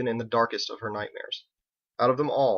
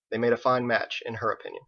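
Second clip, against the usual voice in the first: ordinary delivery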